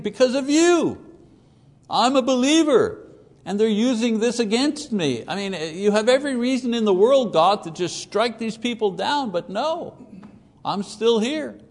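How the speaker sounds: high, pleading, indignant voice; pleading complaint. Retold or imitated. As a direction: protest to God like a wronged believer. Voicing the sufferer's complaint.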